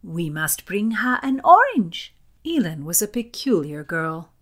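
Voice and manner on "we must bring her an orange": high, louder burst of concern; lightly voiced impulsive young lady; eager outcry. A narrator's reading. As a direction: pipe up with earnest urgency. Elin's eager cry continues.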